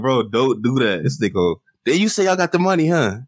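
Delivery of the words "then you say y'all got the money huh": high-pitched kid-like mocking voice; J C impression. Podcaster mocking a battler on camera with Beasley, kid-like voice. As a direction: mimic him like a pestering little kid. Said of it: "that's how he sound".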